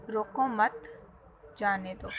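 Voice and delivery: رکو مت said as one unit, pause, then جانے دو; pause after مت. Shown as a demonstration. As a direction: break the phrase after مت, before جانے دو. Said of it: "ہم نے وقفے کی تبدیلی".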